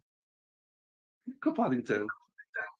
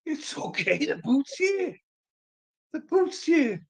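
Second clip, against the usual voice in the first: reassuring. Real, not acted. Parent reassuring upset child.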